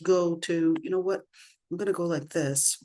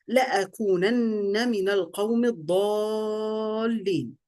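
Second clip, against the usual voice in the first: melodic recital with long-held vowels; lilting Quranic recitation; 3 semitones higher, faster than usual. Acted. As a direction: chant the Arabic verse, stretching the final words. Recites Quran verse in Arabic.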